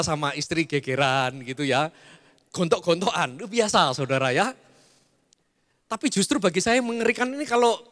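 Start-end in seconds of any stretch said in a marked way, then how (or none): none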